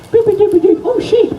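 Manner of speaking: high comic boops; silly high sound effects; boop noises. Acted. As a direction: pop them out like a cartoon.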